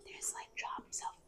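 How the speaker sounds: slow hushed whisper. Real, not acted. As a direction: whisper it slowly. Whispering in a cave.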